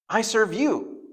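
high, pleading protest; a high, insistent protest; earnest insistence. Retold or imitated. Peter insisting on his role.

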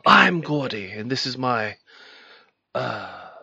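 hammed-up posh British host voice; playful fake British accent; mock British accent. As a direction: put on a jokey British accent to open the show. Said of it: "I'm doing a perfect British accent".

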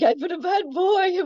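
high, sheepish naughty-pet voice; guilty camel voice. Retold or imitated. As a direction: voice the camel owning up.

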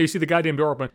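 normal talking voice

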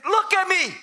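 high-pitched indignant outburst; acted angry command; angry, arrogant. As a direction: demand it with hateful scorn. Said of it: "maybe there was an angry tone".

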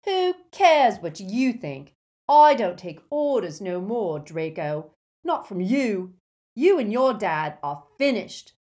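loud, defiant henchman's tirade; undisguised ferocity. A narrator's reading. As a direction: turn fiercely on a former boss. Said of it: "with undisguised ferocity".

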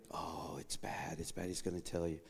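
low, soft, fearful murmur; hushed dread. Retold or imitated. Preacher voicing his anxious thoughts in the exam room. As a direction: let worry creep in quietly.

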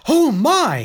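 high, loud amazed cry; loud, high surprised exclamation; exclaimed. A narrator's reading. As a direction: gasp it out in wonder. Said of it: "he exclaimed".

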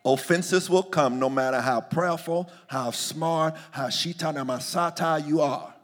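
ordinary talk, nothing marked

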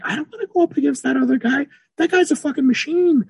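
high, intimidated athlete voice; scared athlete. Retold or imitated. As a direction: squeak it out like a rattled rival. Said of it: "voicing the scared rival athlete".

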